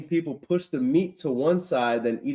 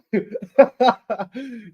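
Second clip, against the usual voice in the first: a long, falling laugh; can't stop laughing at it; 7 semitones higher than usual. Real, not acted. Laughs at camel drumsticks.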